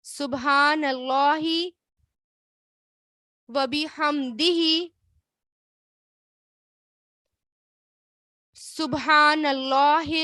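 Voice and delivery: phrase by phrase, with long pauses between; slow recitation. Shown as a demonstration. Teacher recites the dhikr phrase by phrase for students to repeat. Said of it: "یہ تو سب کو آتے ہیں۔".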